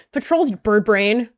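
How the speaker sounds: loud, slow scold; slow, loud wake-up scold. Acted. Leader scolding a dozing cat, loud and slow.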